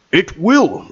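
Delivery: high, falling quoted reply; first go at Bane. Retold or imitated. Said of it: "let me do my Bane impression".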